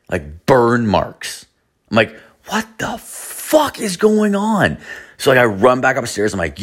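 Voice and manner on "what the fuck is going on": high, slow, stretched-out shock; freaked-out disbelief. Retold or imitated. Alone in the garage, spooked, high and drawn out. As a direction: let the words climb high in alarm. Finding burned Ouija board.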